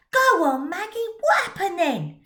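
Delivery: put-on impatient young lad pleading; eager boy demanding. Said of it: "demanded Tommy Tucker".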